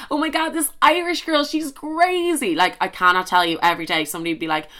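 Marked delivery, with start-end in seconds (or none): 0.1-2.5 American impression. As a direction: mimic a gushing American, pitched up. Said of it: "American people just think".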